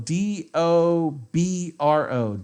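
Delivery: D O B R O spelled out slowly letter by letter. Shown as a demonstration. Streamer spelling a Russian word for chat, slow letter by letter. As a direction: spell D O B R O out one letter at a time. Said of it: "the third word is".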